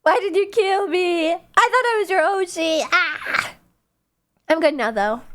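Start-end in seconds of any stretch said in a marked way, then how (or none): 0.0-3.5 higher, drawn-out mock dying wail; hammed-up wounded whine, ending in a cry; mock betrayal. Streamer pretending chat killed them by choking, mock betrayed.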